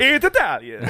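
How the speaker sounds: put-on high, loud hammy Italian accent; wacky Italian guy. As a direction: wave your arms and shout it Italian-style. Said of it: "arm-waving Italian guy".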